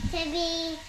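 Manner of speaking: ordinary talk, nothing marked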